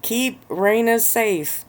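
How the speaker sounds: high, chanted in unison; group in unison. A narrator's reading. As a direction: chant it like a whole room together. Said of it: "The entire room finished in unison".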